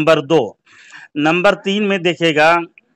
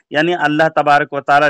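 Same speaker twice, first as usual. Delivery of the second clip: normal talking voice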